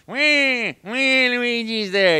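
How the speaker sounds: very high, loud, slow squeal; Luigi. Shown as a demonstration. Luigi shows up at Mario Tennis, squealing high and slow. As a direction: stretch the cheer out high. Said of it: "record Mario Tennis".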